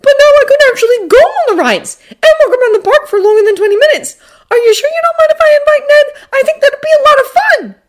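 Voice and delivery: put-on high, loud, excited boy; gleeful rambling. A narrator's reading. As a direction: bubble over with glee as a kid. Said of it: "continues gleefully rambling".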